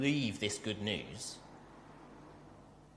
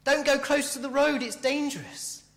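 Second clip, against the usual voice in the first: high, alarmed parental yell, easing at the end; imitated mum's shout; 13 semitones higher, 4 dB louder than usual. Mum to child cycling near the road, shouted warning. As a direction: call out a sudden warning, as a worried mum would. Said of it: "of course, she shouted".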